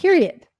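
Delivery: said high and loud to close the point; emphatic period. Real, not acted. Coach insisting to host that grief is valid, emphatic. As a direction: slam the point shut.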